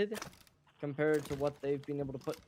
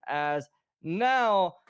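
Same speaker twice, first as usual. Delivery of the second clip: louder hyped caster call; excited; 4 semitones higher, 8 dB louder than usual. Real, not acted. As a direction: lift it for the big finish. Caster hyping the win.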